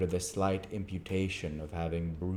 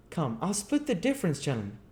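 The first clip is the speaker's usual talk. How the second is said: much higher, coaxing rough voice; urging chimney sweep; 11 semitones higher, faster than usual. Acted. Gamfield urges the board to compromise, high and coaxing. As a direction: urge a compromise. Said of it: "urged Gamfield".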